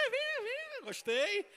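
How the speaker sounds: squeaky high coaxing. Acted. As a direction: lure the volunteer in a high playful voice. Picking a volunteer playfully.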